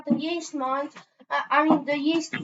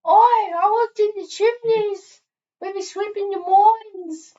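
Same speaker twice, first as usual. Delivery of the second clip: cockney chimney sweep, slow; Oi, a London street urchin; British accent; 4 semitones higher, 4 dB louder than usual. Acted. Plays Little Timmy, British chimney sweep.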